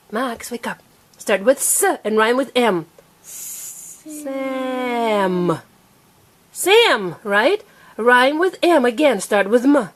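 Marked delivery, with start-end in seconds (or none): 4.0-5.6 SAM with a long hissed S and held M; stretched out. Shown as a demonstration. Teacher prompting a child's rhyme, stretched out. Sounding out, then blended word. 6.5-7.2 SAM short and higher; said fast. Shown as a demonstration. Teacher confirming the rhyme, said fast. Sounding out, then blended word.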